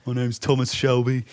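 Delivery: Thomas Shelby. Acted. Actor attempting a hard accent, in mock Birmingham. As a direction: act the Peaky Blinders lead in Brummie. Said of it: "the Birmingham accent".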